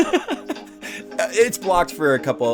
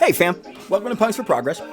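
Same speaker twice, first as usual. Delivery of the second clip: normal talking voice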